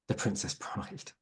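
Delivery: soft slow whispered aside. Real, not acted. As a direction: drop to a breathy whisper. Quiet aside after examples.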